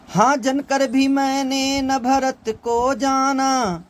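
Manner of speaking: Kaikeyi's lament recited slowly as verse; poem recitation. Acted. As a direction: lift the line and stretch it like recited poetry.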